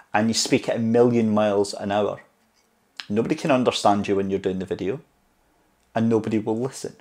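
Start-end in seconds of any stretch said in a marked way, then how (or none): none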